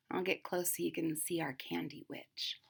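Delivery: soft unvoiced whisper. Real, not acted. Whispering to the children while showing the picture. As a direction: lean in and whisper the aside. Reader whispers aside to kids.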